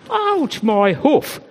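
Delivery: sudden high yelp of pain; hurt sheep crying out. A narrator's reading. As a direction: squeal it like a trodden-on sheep. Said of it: "cried the sheep".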